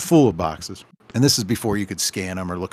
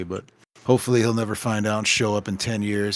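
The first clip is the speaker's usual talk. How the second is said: ordinary talk, nothing marked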